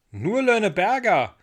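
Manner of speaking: put-on boy calling up, querying; called echo question. A narrator's reading. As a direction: repeat it back as a loud question. Michel calls back up, echoing Ida.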